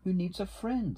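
high storybook character voice; little beaver. A narrator's reading. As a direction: speak up as an eager-to-help little beaver. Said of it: "said Little Beaver".

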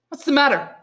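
high, loud alarmed question; crying out. A narrator's reading. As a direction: cry out the question, pitched well up. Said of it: "cried Dantes".

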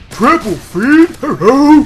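high, loud triumphant crowing; excited outburst. Real, not acted. Gamer crowing over a triple feed, excited shout.

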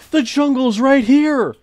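high, slow, loud thrilled exclamation; drawn-out thrilled shout; excited. Real, not acted. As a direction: cry out in delighted surprise. Discovers jungle nearby.

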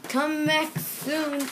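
slow sing-song call. Acted. Seals calling farewell.

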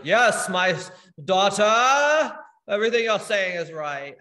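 loud, hammed-up divine proclamation; booming voice of God. God approving of his daughter from above, booming voice. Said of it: "I thought God was like".

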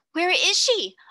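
almost shriek the question; near shriek. A narrator's reading. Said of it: "almost shrieked Jessie".

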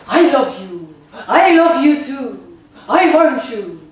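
overdreven, uitgeroepen liefdesverklaring; gespeelde Amerikaanse liefdesverklaring. Acted. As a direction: roep het uit als in een Amerikaanse film. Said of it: "in Amerika wordt er tenminste de hele dag van je gehouden". (overdreven Amerikaanse liefdesverklaring)

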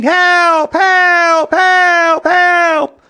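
high, loud repeated screams; yelling and screaming. A narrator's reading. Lady with hair on her tummy, screaming for help. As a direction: scream for help, each cry equally high. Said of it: "who was yelling and screaming".

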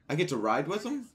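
can't believe his luck; delighted gasp. Real, not acted. Delighted surprise.

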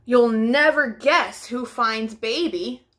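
ordinary delivery

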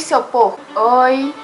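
usual speaking voice

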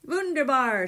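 delighted shout, high and loud. A narrator's reading. Doctor seeing into the third box, shouting. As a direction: exclaim it out loud. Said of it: "shouted Doctor Bose".

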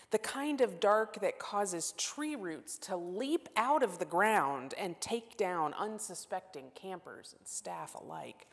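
ordinary delivery

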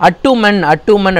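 அட்டுமன் with a short first vowel and "uman" ending; mistaken pronunciation. Shown as a demonstration. Teacher showing pupils a wrongly taught word, mistaken pronunciation. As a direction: say அட்டுமன் as "at-TOO-man". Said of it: "இதோட pronunciation ரெண்டு மூணு பேர் சொல்லி கொடுத்தாங்க".

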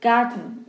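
Garten ending in a throat-held n; swallowed -en ending. Shown as a demonstration. Teacher repeating for learners, Garten with swallowed -en. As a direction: drop the e of Garten and trap the n in the throat.

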